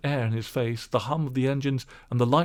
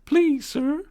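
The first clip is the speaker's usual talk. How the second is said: put-on high, louder pleading old woman; washerwoman's voice; 5 dB louder than usual. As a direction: ask sweetly. Toad as washerwoman.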